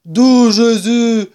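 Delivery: exclaiming. A narrator's reading. Badger startled by the rat, loud drawn-out exclamation. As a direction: cry it out long and loud. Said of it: "s'écria Blaireau".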